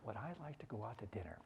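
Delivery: very soft, low musing aloud; pondering to oneself. Acted. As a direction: repeat the question quietly to yourself, thinking. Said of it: "then their eyes will go to the side".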